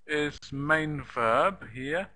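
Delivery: normal talking voice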